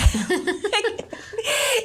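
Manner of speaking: a burst of high laughter; real high-pitched laugh at her own joke. Real, not acted.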